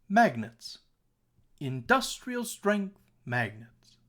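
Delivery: DUS and STRENGTH pitched high, last syllable of "magnets" dropped. Shown as a demonstration. Teacher showing that pitch goes down when unstressed, exaggerated stresses. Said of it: "listen very carefully once more".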